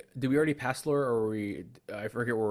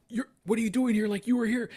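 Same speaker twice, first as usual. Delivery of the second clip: much higher, louder panicked retelling; panicked quote; 10 semitones higher, 3 dB louder than usual. Retold or imitated. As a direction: reenact the freaked-out guy, voice shooting up. Retold panicked friend in horror scene.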